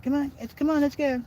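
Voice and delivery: very high put-on dog voice; the dog's voice. Shown as a demonstration. Owner demonstrating his dog's voice to viewers, very high and squeaky. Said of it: "I forgot to do her voice".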